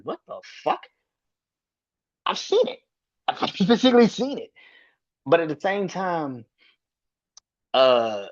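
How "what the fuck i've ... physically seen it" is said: amazed. Real, not acted. Incredulous recollection.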